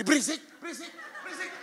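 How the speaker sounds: mock snapping rebuke. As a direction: snap it repeatedly, playfully fed up. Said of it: "langsung bentak itu". (bentakan pura-pura kesal)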